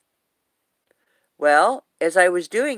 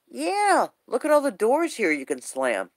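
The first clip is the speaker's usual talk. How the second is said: teasing husband; 7 semitones higher than usual. Retold or imitated. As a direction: playfully needle her with a high-pitched agreement. Imitating husband teasing her in story.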